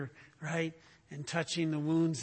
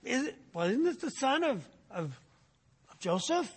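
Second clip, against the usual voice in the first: puzzled, higher questioning voice; mock-confused townsfolk, pitched up; confused crowd; 7 semitones higher than usual. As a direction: play the baffled crowd asking. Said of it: "They're like".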